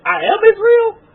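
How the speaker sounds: stunned by the news; astonished disbelief. Retold or imitated. Retelling astonished revelation.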